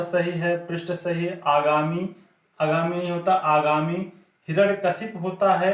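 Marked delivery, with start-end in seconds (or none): none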